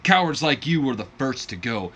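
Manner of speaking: loud, taunting wrestler promo voice; back in promo character. Acted. War hero on the mic calling out the coward, loud. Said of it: "I broke character".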